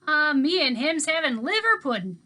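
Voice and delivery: put-on easygoing old man talking to kids; Mookie's voice. A narrator's reading. Mookie's Southern voice.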